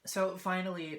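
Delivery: ordinary delivery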